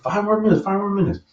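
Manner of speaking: high mimicking repeat; lightly voiced friend parroting; mocking friend. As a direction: throw his words back at him. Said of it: "He was like".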